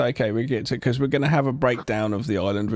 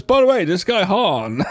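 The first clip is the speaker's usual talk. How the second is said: mock announcement; 8 semitones higher, 7 dB louder than usual. Mocking coincidence in plot.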